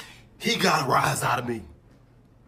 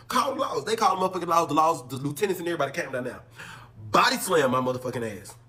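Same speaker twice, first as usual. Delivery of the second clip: ordinary talk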